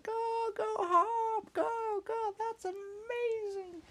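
very high loud cheering voices; crowd cheer. Acted. The crowd cheering Hop on, very high cheer.

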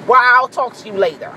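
usual speaking voice

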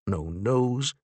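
ordinary delivery, nothing marked

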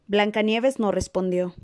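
usual speaking voice

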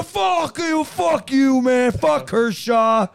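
loud, high, drawn-out taunt; slow, belted abuse; heckling fan yell. Acted. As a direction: holler it like a rowdy stadium heckler. Acting out heckling fans.

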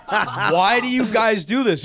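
high, loud, slow, laughing through it; retelling the cliff friends, laughing incredulous question; laughing disbelief. Retold or imitated. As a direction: ask it half-laughing in disbelief. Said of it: "I'm like".

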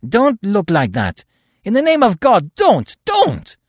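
high, loud, desperate begging; frantic shout to ward them off; shouted plea. A narrator's reading. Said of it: "he shouted".

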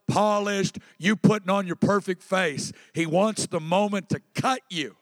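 usual speaking voice

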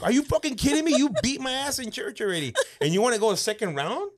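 high, loud outraged whine; outraged protest. Retold or imitated. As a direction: protest like a wronged kid. Outraged kid protesting in church.

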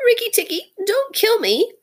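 very high, timid squeaky pleading; almost weeping. A narrator's reading. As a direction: plead in a tiny, tearful creature voice. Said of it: "almost weeping".

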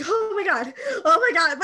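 excited squeal. Retold or imitated. Losing it over Barba's return.

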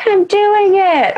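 high, slow and proud; triumphant. Retold or imitated. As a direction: cheer yourself on. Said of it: "And I'm like".

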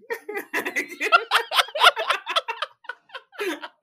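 a burst of loud laughter; loud high laugh. Real, not acted. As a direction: cackle out loud, high and hard. Finds the mute button.

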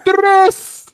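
high, loud hype call; excited. Acted. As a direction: shout it like a hype man. Hype tag line.

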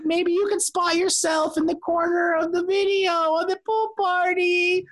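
chirpy fake-friendly PR voice; customer service voice. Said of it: "Is that your customer service voice?".

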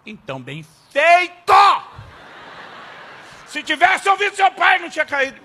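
hammed-up smug dad; gloating shout. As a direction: rub it in loudly. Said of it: "Dei sermão".

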